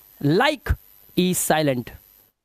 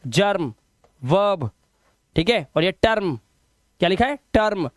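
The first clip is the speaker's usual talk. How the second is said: ordinary talk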